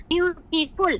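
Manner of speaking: Beautiful split as biu, ti, ful; broken into syllables. Shown as a demonstration. Teacher showing a multi-clap adjective, broken into syllables. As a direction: separate beats through beautiful. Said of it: "দুইবার ভেঙে বললাম".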